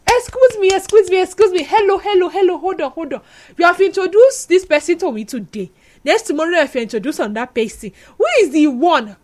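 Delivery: high, insistent mock confrontation; exasperated interruption. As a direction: cut in urgently, demanding he stop and explain. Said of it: "at some point, I got tired".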